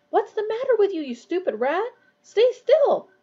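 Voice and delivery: high-pitched, rising to a loud sharp order; exasperated scolding. A narrator's reading. Ron scolds his struggling rat, exasperated and loud. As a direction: tell off a pet at the end of your patience.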